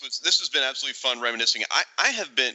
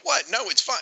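mock clueless; 11 semitones higher than usual. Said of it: "I'm like".

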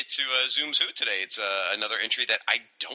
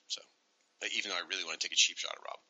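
fast, whispered aside; 6 semitones lower, 9 dB quieter, faster than usual. Real, not acted. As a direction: whisper it as a joke. Aside about joke.

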